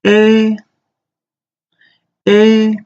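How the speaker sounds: eh high, loud and level; first tone eh. Shown as a demonstration. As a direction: hold eh high and flat. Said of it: "I'm just gonna repeat it again".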